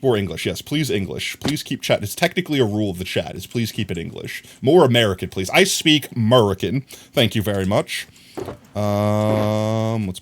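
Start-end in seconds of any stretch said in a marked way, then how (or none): none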